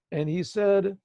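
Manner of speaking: ordinary delivery, nothing marked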